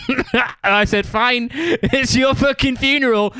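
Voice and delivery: high, drawn-out words through real laughter; laughing. Real, not acted. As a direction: crack up while delivering the retort. Laughs through his own retold retort.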